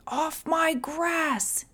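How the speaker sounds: high, slow wail; fussy neighbour wailing over his lawn; wailing old man. A narrator's reading. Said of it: "he wailed".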